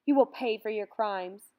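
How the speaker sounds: loud accusing outcry; a betrayed mother crying out a curse. A narrator's reading. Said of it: "Mama cried out".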